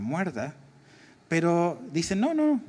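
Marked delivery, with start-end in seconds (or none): 2.2-2.6 high-pitched mock "no, no"; imitated denial. Preacher voices someone denying the girl's death, high protest. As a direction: quote a quick objection, pitched well up. Voicing a contradiction in the story.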